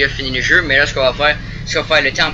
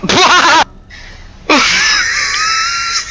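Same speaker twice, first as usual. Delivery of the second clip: rire strident qui part en cri; éclate d'un rire suraigu; fou rire aigu. Real, not acted. Fou rire après un bug. (fou rire hurlé)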